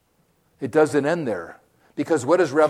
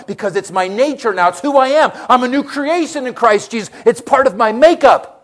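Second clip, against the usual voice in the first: higher, fervent preacher's voice; lifted preaching; 7 semitones higher, 6 dB louder than usual. Acted. Preacher proclaims new creation to the congregation, fervent and high. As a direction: proclaim it with joy.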